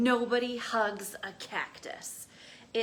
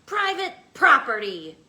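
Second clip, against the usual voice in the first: much louder grumpy bark; yell; 3 semitones higher, 12 dB louder than usual. Acted. Cactus yelling at a tortoise, grumpy. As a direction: snap at the intruder. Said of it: "yelled Hank".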